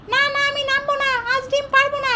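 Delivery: high, pinched little duck refusing; sulky cartoon duck whining no. Mother voices the duck Daki.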